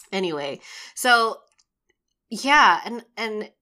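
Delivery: ordinary delivery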